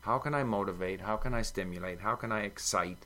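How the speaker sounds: ordinary delivery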